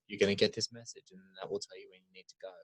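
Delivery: very soft, low imitation; deliberate, hushed. Retelling a shrine-like scene with low lighting, hushed and low. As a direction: drop to a near-murmur, low and grave. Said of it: "he talks really slowly and deliberately".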